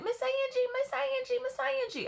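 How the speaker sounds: put-on very high child voices calling; children calling out. Kids on the playground calling their teacher, very high. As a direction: call her name like excited little kids. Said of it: "how little Southern children say my name".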